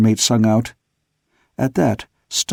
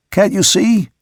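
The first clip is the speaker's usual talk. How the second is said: urgent call; 7 semitones higher, 3 dB louder than usual. A narrator's reading. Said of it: "I sung out".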